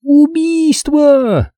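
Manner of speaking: очень высокий протяжный визг медсестры; визжи в панике, растягивая слово; истошный визг. A narrator's reading. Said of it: "истошно завизжала". (медсестра истошно визжит)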